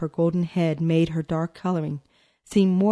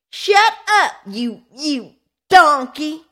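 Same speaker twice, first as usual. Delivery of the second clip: high, loud furious shriek; 10 semitones higher, 7 dB louder, slower than usual. A narrator's reading. Boy shrieking at his sister. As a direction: shriek it out of control. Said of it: "he shrieked uncontrollably".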